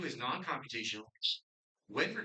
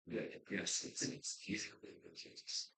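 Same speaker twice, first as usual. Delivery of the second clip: whisper; 6 semitones lower, 7 dB quieter than usual. Real, not acted. Quiet aside while on live stream.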